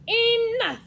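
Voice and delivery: loud, high cut-off order; commanding shout. Acted. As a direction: silence the squabbling animals loudly. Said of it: "said Badger".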